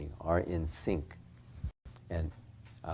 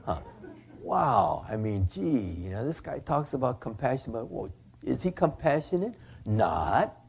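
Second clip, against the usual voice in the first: scoffing students; 6 semitones higher than usual. Retold or imitated. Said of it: "the students, of course, said".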